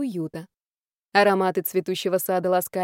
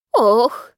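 loud pained oh; feigned heart attack gasp; 2 semitones higher, 7 dB louder than usual. Acted. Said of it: "Она схватилась за сердце".

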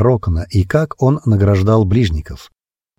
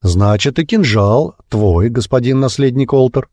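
ordinary delivery, nothing marked

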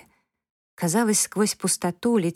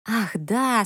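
sudden realization; 5 semitones higher than usual. Acted. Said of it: "спохватилась Унция".

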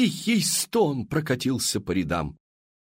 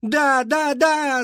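very high, loud yes; crowd roaring; 5 dB louder, much slower than usual. A narrator's reading. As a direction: shout all together. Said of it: "заорали все". (общий громкий высокий ор)

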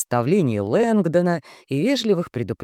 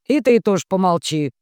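dismissive; 6 semitones higher, 5 dB louder than usual. Acted. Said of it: "отмахнулся шеф".

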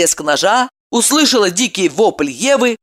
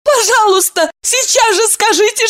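high, tearful begging; tearful pleading. A narrator's reading. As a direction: plead through tears. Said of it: "со слезами взмолилась".

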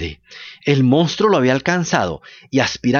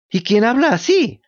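frightened question; 8 semitones higher than usual. A narrator's reading. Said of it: "sintiéndose helar del miedo".

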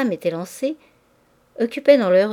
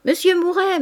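high, bright calling of his name, higher; ladies calling out; 10 semitones higher, 5 dB louder than usual. A narrator's reading. Ladies in the salon calling Mouret over, high and playful. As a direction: call him from across the salon. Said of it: "Des voix répétaient au milieu de légers rires".

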